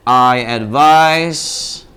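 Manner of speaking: "I advise" drawn out very slowly; slow teacher model of "I advise"; slow example phrase. Shown as a demonstration. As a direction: spell out "I advise" at a slow crawl.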